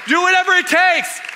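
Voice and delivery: high, loud preacher's cry; rallying shout. Real, not acted. Pastor urging the church to bring Christmas joy, loud shout. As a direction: fire them up to go all in.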